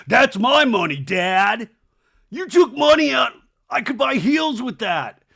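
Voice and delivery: high, loud daughter's tantrum at Dad; hammed-up shrieking protest; outraged yelling. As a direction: yell it as an indignant mock brat. Voicing Isabella yelling at her dad.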